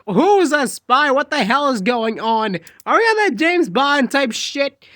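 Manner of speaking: shocked high yell, slow on who's; yelling in disbelief at the knife; I'm like. Retold or imitated. Confronting Kobani, a loud high disbelieving yell. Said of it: "and I'm like".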